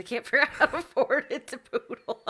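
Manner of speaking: breaking into real laughter while talking; laughing. Real, not acted. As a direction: laugh helplessly through the admission. Laughing at own phone incompetence.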